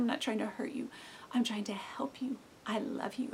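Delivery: put-on soft, hushed comfort to a child; tender reassurance. Parent to a sad child, softly reassuring. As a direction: murmur it like lifting a child's chin.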